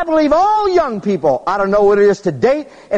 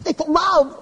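mock lovestruck echo; 7 semitones higher, 4 dB quieter than usual. Preacher echoing boy mockingly.

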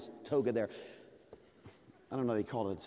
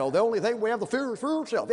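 put-on old-time orator; 6 semitones higher, 4 dB louder than usual. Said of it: "all the guys back then all talk like that".

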